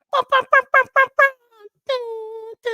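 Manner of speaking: loud, high rapid-fire bum-bum blasts; dancehall horn noise. Retold or imitated. Host showing how they go, mock dancehall horns. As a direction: imitate the sound effects of a dancehall. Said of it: "You know how they go?".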